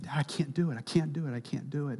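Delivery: a quiet, broken-hearted plea; softly retold plea. Boy pleading with father.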